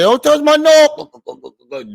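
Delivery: high, loud, slow frantic yell; guard the nog like a frantic cartoon dad; cartoon dad bugging out. Imitating Timmy's dad over his eggnog, frantic high yell. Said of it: "tripping over the eggnog every time".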